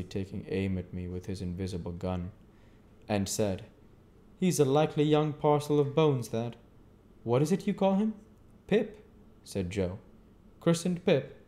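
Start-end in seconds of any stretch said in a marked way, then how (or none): 4.4-8.1 higher, louder stranger's voice. A narrator's reading. Stranger asks about Pip. 8.7-9.1 lightly voiced simple blacksmith, a bit higher; a hint of easygoing Joe. A narrator's reading. Joe answers. 10.6-11.3 higher, louder stranger's voice. A narrator's reading. Stranger asks.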